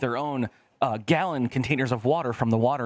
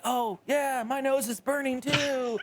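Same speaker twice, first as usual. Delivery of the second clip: much higher, put-on faking voice; mock younger self; 12 semitones higher than usual. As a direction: ham up going along with the crowd. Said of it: "I'm like".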